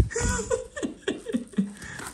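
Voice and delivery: high breathy giggle; high squeaky giggle. Acted.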